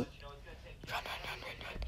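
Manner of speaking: whispered, hurried run-run-run; urgent whisper. Real, not acted. Player urging own character along, urgent whisper. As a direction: mutter it very softly under your breath. Urgent mutter while playing.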